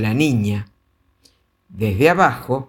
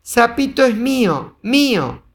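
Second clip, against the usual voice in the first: put-on clutch it like a toddler; possessive little girl; 9 semitones higher than usual. A narrator's reading. Little girl grabbing the toad, high possessive cry. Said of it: "decía la niña".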